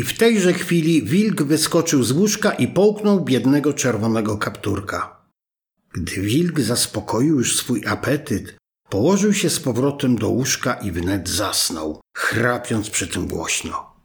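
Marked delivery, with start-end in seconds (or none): none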